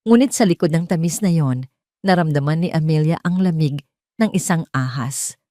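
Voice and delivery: ordinary delivery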